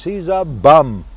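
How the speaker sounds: loud, high, drawn-out mock yell; mother yelling. Mother yelling about Sinatra from the background, imitated yell. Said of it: "my mother yells".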